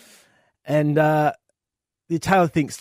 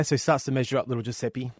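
ordinary talk, nothing marked

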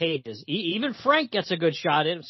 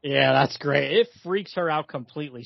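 normal talking voice

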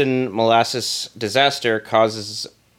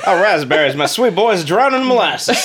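high, loud wailing with laughter; overacted grieving parent; old-timey parent; 8 semitones higher, 4 dB louder than usual.

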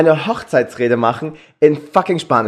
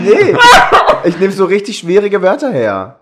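laughing; 5 semitones higher, 7 dB louder than usual. Real, not acted. Laughing through his wedding-speech boast.

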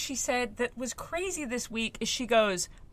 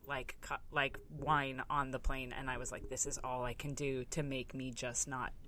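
resigned; 7 semitones lower, 9 dB quieter than usual. Retold or imitated. Mother recalling wine on a doomed flight, quietly resigned. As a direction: drop low and soft, giving up.

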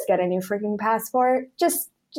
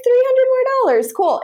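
naive client voice; 5 dB louder than usual. Retold or imitated. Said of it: "They're like".

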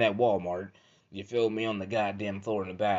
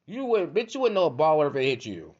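high, loud mocking comeback; scornful retort; 6 semitones higher, 5 dB louder than usual. Man answering girl who wants ballers, loud mocking retort. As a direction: fire it back high with scorn.

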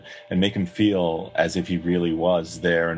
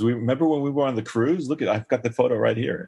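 imitating father-in-law; 5 semitones higher than usual. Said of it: "he goes".

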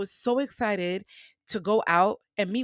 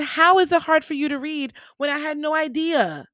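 raised, louder retold disbelief; shocked disbelief; 7 semitones higher, 6 dB louder than usual. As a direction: throw the question back in surprise. Retelling her shocked reply.

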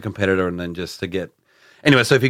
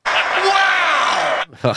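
a wow thrown up high in delight; exclaimed wow. Real, not acted. Co-host marvelling at a cheeky footy tip, high wow. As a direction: yelp it out, amazed. Reacting to the Oons pick.

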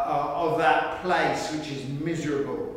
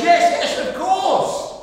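eager yes; 11 semitones higher, 7 dB louder than usual. Retold or imitated. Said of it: "And the man goes".

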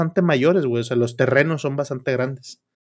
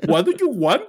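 imitating Apu's Indian accent, pitched higher; 7 semitones higher than usual. Cashier asks what he wants, in an imitated Apu Indian accent. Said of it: "hablaba como Apu".

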